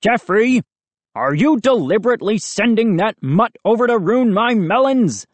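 high, irate neighbour voice; Mister McCall calling. A narrator's reading. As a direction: call out an accusation, pitched high. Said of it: "Mister McCall called".

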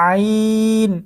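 আইন from a pressed throat, long held ee; three-alif stretch. Shown as a demonstration. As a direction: squeeze the throat, then stretch the ee of আইন evenly. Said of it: "গলাটা একটু চাপ দিয়ে". (গলায় চাপ দিয়ে আইন টেনে)

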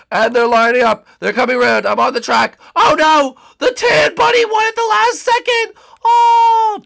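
high loud commentary building to a shriek; excited race calling. Acted. Watcher seeing the race lost at the end, shouting. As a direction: call the finish, climbing to a dismayed yell.

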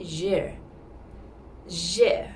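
je with a little R sound at the back; soft R ending. Shown as a demonstration. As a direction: end je with a light R. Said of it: "add a little bit of R sound".

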